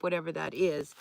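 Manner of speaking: ordinary delivery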